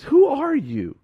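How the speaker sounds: high, loud, startled question with a laugh. Acted. Saul, struck down, asks the heavenly voice who it is, startled. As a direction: jump at the voice from nowhere.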